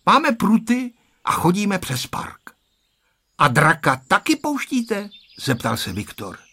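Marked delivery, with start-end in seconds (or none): 0.1-2.5 put-on high, slow child voice; high-pitched boy, unhurried; boy's voice. A narrator's reading. Boy chatting, voiced in story. 3.4-5.1 put-on high-pitched boy asking; high, questioning kid voice; child's question. A narrator's reading. Child asks question in story.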